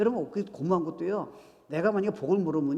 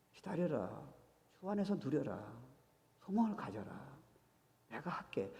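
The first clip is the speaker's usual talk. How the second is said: gentle God's voice; 8 dB quieter than usual. Pretend. Voicing God's gentle words.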